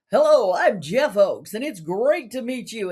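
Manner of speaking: a young man introduces himself at church, mock-evangelist voice; TV evangelist greeting. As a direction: greet them like a slick TV evangelist. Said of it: "nobody talks like this".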